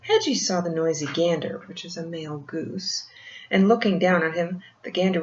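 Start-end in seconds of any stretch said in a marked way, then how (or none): none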